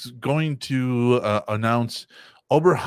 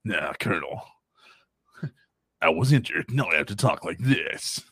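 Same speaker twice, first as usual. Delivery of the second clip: injured raspy Snake; 6 semitones lower than usual. Pretend. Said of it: "takes a shot to the throat".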